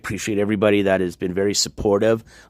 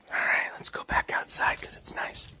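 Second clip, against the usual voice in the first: soft, voiceless whisper; 9 dB quieter than usual. Real, not acted. Streamer heading back outside, whispering. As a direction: whisper it under your breath.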